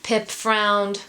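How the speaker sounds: plain reading voice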